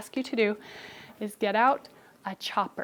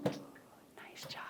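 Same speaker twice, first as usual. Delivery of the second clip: whispered praise; 21 dB quieter than usual. Real, not acted. Quiet praise to child.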